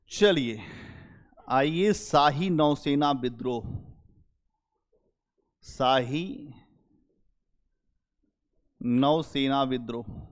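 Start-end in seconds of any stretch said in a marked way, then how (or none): 5.6-10.3 spaced out and slowed, word by word, for note-taking; dictating the title slowly to the class; slow dictation. Shown as a demonstration. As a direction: read it out slowly so they can write it down. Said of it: "यहीं पे लिख लो note".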